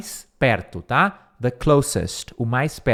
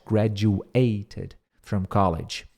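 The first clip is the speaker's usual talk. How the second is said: slow repeat; 7 semitones lower, 4 dB quieter, much slower than usual. Shown as a demonstration. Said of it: "slow repeat".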